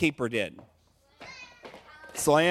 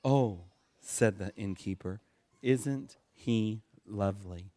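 soft, slow and much lower; tender innkeeper; 9 semitones lower, 6 dB quieter, slower than usual. A narrator's reading. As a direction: melt as you look at the newborn. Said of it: "his anger seemed to fly away".

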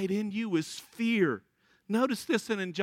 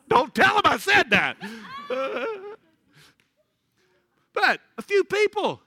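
louder, laughing confession; laughing aside; 10 semitones higher, 6 dB louder than usual. Real, not acted. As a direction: giggle it out.

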